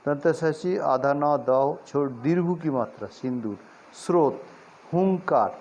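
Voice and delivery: ordinary talk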